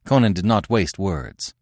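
ordinary delivery, nothing marked

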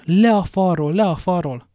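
loud, high-pitched urgent shout; crowd shout. A narrator's reading. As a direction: shout like a whole camp yelling up. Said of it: "kiáltja egy emberként az egész tábor".

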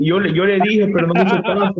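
high, loud scolding granny; grandma voice. Retold or imitated. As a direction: squeak it out like a fed-up grandma.

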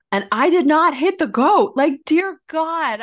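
high, loud, slow insistence; indignant protest. Real, not acted. Insisting to her friend she never hit the goat, high loud protest. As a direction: swear you didn't do it. Emphatic denial of hitting goat.

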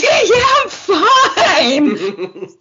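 high, teary laugh through tears; tearful fine. Retold or imitated. Injured runner telling walkers she's fine, tearful and high. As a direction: insist you're fine while crying and laughing. Crying while claiming fine.

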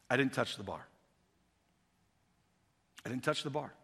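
low, soft, understated delivery; quiet spotter's reveal. Acted. Spotter telling the lifter the truth, quiet and low. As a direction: say it softly and let it sink in. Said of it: "And the spotter says to you".

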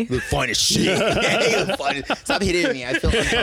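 high, loud and laughing; giggling through a fake pickup line; laughing mock flirt. Mock flirting then mock protest.

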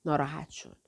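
plain reading voice

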